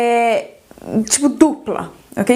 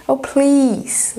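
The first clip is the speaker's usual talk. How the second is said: ordinary talk, nothing marked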